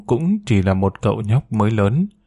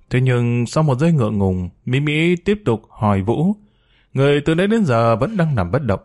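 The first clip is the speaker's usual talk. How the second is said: ordinary delivery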